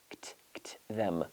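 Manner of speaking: "kt" whispered, then a soft "them"; whispered kt cluster. Shown as a demonstration. Teacher isolating "kt" in a whisper for students. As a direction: hiss the kt of "asked" under the breath. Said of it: "hit that asked".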